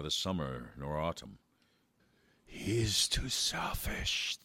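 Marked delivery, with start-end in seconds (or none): 2.4-4.4 slow breathy whisper. Acted. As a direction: whisper it like the cold wind. Garden voices judge giant.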